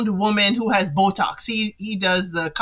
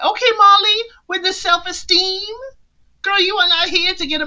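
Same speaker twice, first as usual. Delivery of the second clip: sassy; 10 semitones higher than usual. Real, not acted. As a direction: call her out with playful attitude. Sassy remark on contestant.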